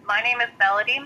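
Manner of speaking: ordinary talk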